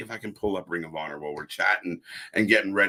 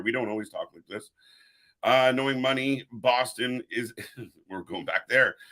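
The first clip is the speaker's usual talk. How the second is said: ordinary delivery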